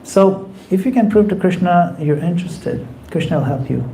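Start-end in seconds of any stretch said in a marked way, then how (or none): none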